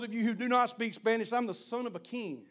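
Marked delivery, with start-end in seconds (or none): none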